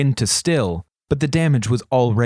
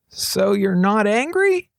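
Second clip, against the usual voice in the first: put-on tentative teen, higher and slow; timid question; 8 semitones higher, slower than usual. A narrator's reading. Kuro asking regent after his laughter, tentative higher voice. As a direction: venture it carefully. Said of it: "Kuro ventured".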